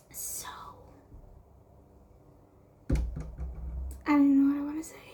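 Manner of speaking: hushed unvoiced whisper. Real, not acted. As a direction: whisper it quickly so nobody hears. Suddenly whispering to camera.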